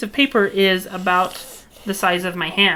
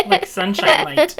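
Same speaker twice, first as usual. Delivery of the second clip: laughing; 8 semitones higher than usual. Real, not acted. Giggling about sunshine light.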